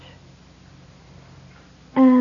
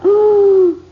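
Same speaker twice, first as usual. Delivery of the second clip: loud, high gasp of horror; a sudden shocked cry on hearing bad news; imitated shock, loud and high; 9 semitones higher, 9 dB louder than usual.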